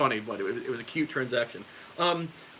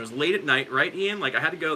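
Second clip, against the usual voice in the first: normal talking voice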